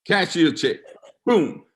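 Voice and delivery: ordinary delivery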